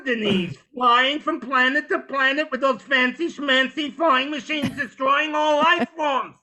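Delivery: high, loud nagging parent; guilt-tripping superhero. Acted. Superhero scolding villain Denise for never visiting parents, guilt-tripping. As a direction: kvetch your way up to an outraged shout. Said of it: "make the villains feel so guilty".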